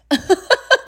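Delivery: short, high-pitched burst of laughter; amused laugh. Real, not acted. Mom amused that nobody wore a mask, high laugh. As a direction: laugh in a quick, high run.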